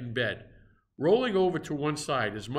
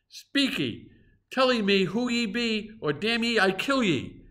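higher, louder threatening demand; growled; 9 semitones higher, 4 dB louder than usual. A narrator's reading. Cannibal demands the stranger's name, angry threat. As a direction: growl the threat in broken English. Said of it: "growled the cannibal".

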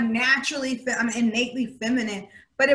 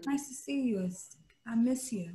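hushed and tender; soft voice; 9 dB quieter than usual. Shown as a demonstration. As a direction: say it softly, just for him. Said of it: "my vocal tone becomes soft and".

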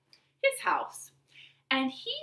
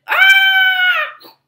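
terrified scream; 17 dB louder than usual. Acted. Monsieur Dupont sees loose lion.

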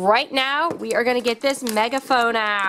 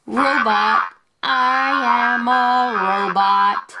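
robot voice; much slower than usual. Shown as a demonstration. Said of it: "Now we've got robot".

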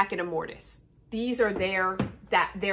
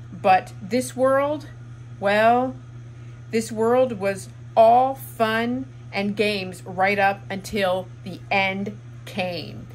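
slow, louder declamation with weighty pauses; dramatic reading; 4 dB louder than usual. Acted. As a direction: read it out like a grand, deliberate farewell proclamation. Reads author's farewell quote dramatically.

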